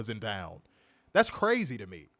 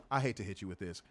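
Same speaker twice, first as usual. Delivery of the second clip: ordinary talk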